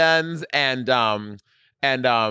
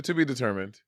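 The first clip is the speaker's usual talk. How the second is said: normal talking voice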